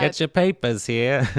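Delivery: loud, high paperboy hawking; old-timey newsboy. Acted. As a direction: call out like a 1930s paperboy. Newsboy call, old-timey voice joke.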